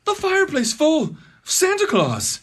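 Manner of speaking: ordinary delivery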